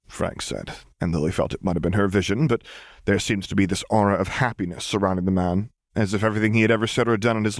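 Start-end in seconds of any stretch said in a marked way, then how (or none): none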